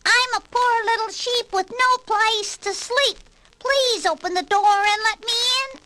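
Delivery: high, sugary fake sheep voice; wolf faking a sweet lamb; sweet little voice. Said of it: "in a sweet little voice".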